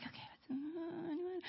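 very soft, tentative ask; hesitant invitation. Retold or imitated. Leaders calling the room to faith, very quietly and hesitantly. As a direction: peter out into a tiny, hopeful question.

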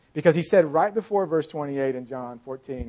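ordinary talk, nothing marked

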